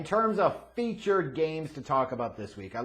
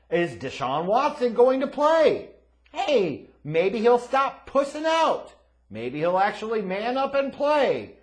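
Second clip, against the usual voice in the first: mocking; 10 semitones higher, 8 dB louder, slower than usual. Real, not acted. Mocking Watson.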